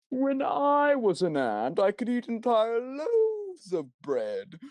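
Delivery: very high, slow squeaky voice; Simple Flips reference. Pretend. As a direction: squeak it like a tiny ant. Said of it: "That's a Simple Flips joke".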